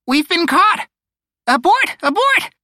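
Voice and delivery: very high, loud panicked bird cry; panicked alarm. Acted. Bird lookout raising the alarm.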